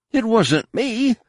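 terrified captive pleading innocence; desperate cry. A narrator's reading. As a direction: beg for your life. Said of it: "Shun Mai cried desperately".

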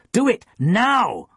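high, loud, drawn-out command; Jensen, Danish-accented shout. A narrator's reading. Cello teacher commanding student, slow Danish-accented shout. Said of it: "in Jensen's accent".

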